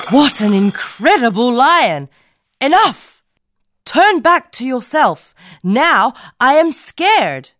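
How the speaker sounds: high, loud mock alarm; mock scared. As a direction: pretend to be frightened. Cat sees the lion.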